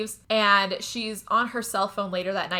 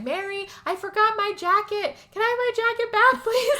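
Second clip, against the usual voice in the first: high, loud pleading whine for the jacket; calling through door; 12 semitones higher, 4 dB louder than usual. Retold or imitated. John at the door asking Mary for his jacket, high mock pleading call.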